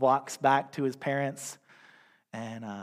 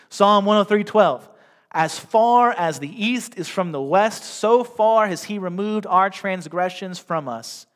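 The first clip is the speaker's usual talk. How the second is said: scripture reading; 4 semitones higher, 6 dB louder than usual. Acted. Pastor reads more scripture verses.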